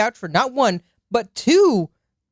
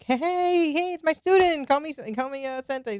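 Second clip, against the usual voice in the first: playful Gojo impression; 9 semitones higher, 4 dB quieter than usual. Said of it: "The way he acts with Fushiguro is like".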